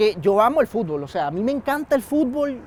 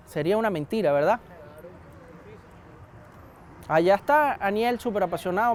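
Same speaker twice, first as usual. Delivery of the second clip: ordinary talk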